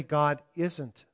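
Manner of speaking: ordinary talk, nothing marked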